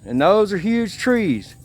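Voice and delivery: ordinary delivery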